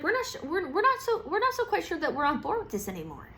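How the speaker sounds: high, faltering doubt; hesitant backing out. Retold or imitated. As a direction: back out politely but nervously.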